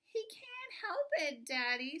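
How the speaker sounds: put-on high-pitched pleading kids; children's plea. A narrator's reading. Children defending their dog to Daddy, high plea. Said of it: "said Betty and Billy".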